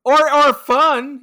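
slow, high, loud teasing; drawn-out teasing. Real, not acted. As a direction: stretch it out playfully. Playful drawn out suggestion.

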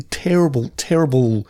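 usual speaking voice

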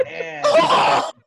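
high giggly laughing shout; nervous laugh. Real, not acted. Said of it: "she got the nervous laugh".

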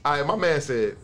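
normal talking voice

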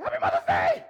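loud, high, bursting greeting; excited greeting. Retold or imitated. Pastor greeting the mothers, loud and thrilled. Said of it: "I did it just like that".

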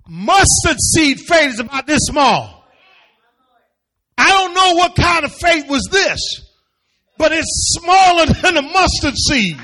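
loud, high-pitched, driving sermon; preaching at full force. Real, not acted. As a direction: preach it out hard to the room.

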